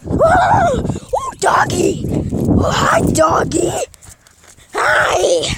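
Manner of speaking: high-pitched squeal to a doggy; gushing high dog greeting; excited calling to a dog. Real, not acted.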